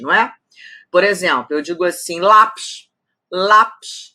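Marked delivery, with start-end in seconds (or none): 2.2-4.1 lápis with a strong first syllable lá; stress on penultimate. Shown as a demonstration. Teacher showing a paroxytone, lápis stressed on lá. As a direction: punch lá in lápis, let pis drop away. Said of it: "A penúltima é a forte".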